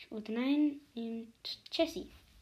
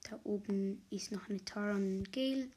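ordinary talk

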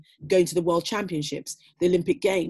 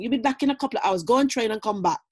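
put-on mum in a strong Jamaican accent, higher and quick; 5 semitones higher, 4 dB louder than usual. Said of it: "in the strongest Jamaican accent".

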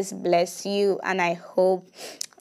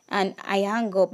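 ordinary delivery

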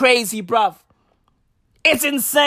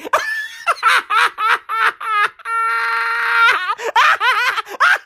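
high, howling, teary laugh; long wild laughter; 11 semitones higher than usual. Real, not acted. Cracking up about Herschel Walker, long wild laughter. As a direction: laugh until it hurts.

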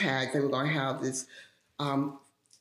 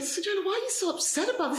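high, fast, lightly voiced baffled colleague; puzzled coworker; much faster than usual. The baseball-loving coworker brushing off her upset, high and quick. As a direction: toss it off quick and high like he doesn't get it.

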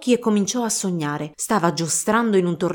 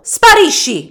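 much higher, much louder yell; furious shout; 9 semitones higher, 11 dB louder, slower than usual. Acted. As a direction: blast the suitor away. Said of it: "gridò il locandiere a Basilio".

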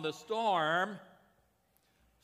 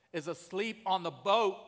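ordinary delivery

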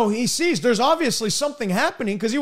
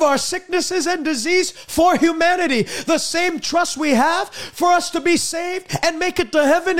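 raised, steady preacher's cadence; fervent preaching; 4 semitones higher than usual. Acted. Preacher declares healing to his listeners in a raised preaching cadence. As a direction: proclaim it to the whole church.

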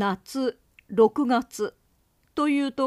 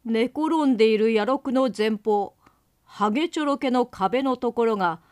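plain reading voice

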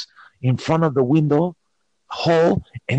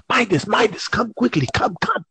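urgent; 8 semitones higher than usual. Retold or imitated. Calling friend urgently.

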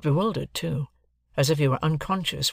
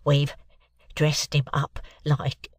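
slow, hesitant, congested reply; nervous; slower than usual. Acted. Mike nervously answers the stern lawyer, hesitant. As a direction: begin haltingly, unsure of yourself. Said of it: "reply in a nervous manner".

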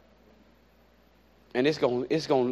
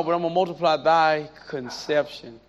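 normal talking voice

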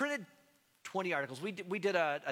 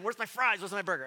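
mock whiny complaint; 4 semitones higher, 6 dB louder than usual. Mocking himself wanting American food.

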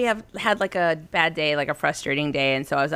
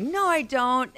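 higher-pitched imitation of a contradicting kid; stubborn denial; 8 semitones higher than usual. Son rejecting mother's note, stubbornly defensive. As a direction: mimic a child's knee-jerk denial.

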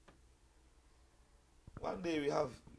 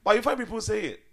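normal talking voice

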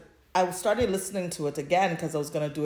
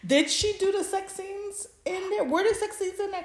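excited curious question. Real, not acted. Eager question about sex scenes.

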